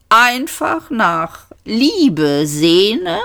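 high, drawn-out Vogon screech; slow, high, menacing sneer; screeching cat-claw voice. A narrator's reading. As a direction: stretch the words out like a cat shredding nylons. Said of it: "wie eine Katze, die Nylonstrümpfe zerfetzt".

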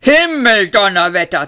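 alarmed war machine roaring; booming curse. A narrator's reading. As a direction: thunder out the oath. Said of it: "dröhnte die Maschine".

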